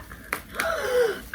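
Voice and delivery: high-pitched gasp of excitement; excited gasp. Real, not acted. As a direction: gasp at the first glimpse. First glimpse inside the box.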